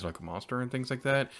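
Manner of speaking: ordinary talk, nothing marked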